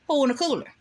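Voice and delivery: high, pushy "who"; insistent question. Retold or imitated. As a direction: demand it again, losing patience. Acted quarrel, asking again.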